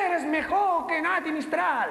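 high, slow call from the balcony. Acted. Woman in the amphitheatre praising the performer, calling out high. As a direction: draw it out like a gushing fan.

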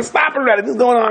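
a retold plea pitched high with alarm; frantic pleading. Terrified families begging the rabbi to stop, a high frantic plea. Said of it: "and they're going".

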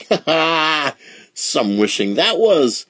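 laughing exclamation. A narrator's reading. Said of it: "she exclaimed".

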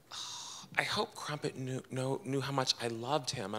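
soft tearful husband. Retold or imitated. Husband grieving the dog.

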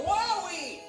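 high-pitched cry of amazement; joyful storybook shout; excited holler. A narrator's reading. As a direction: holler it at a wondrous sight. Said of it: "made them holler".

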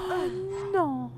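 very high gasp then oh no; panicked falsetto oh no. Real, not acted. Sudden danger in game.

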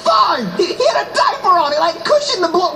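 high-pitched frantic protest; defensive outburst. Acted. Father justifying himself as his partner leaves, exasperated. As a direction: shout excuses after someone, exasperated.

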